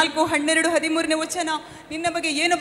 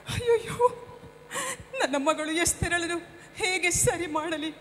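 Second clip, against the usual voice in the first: crying; 4 semitones higher, 7 dB quieter than usual. Acted. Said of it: "ಅಳ್ಬೇಡ ಅಮ್ಮ".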